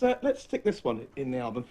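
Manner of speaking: usual speaking voice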